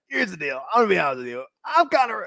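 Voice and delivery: a quick jokey mock of a conspiracy radio host, rising high; Alex Jones impression. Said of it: "I can't say the Alex Jones thing".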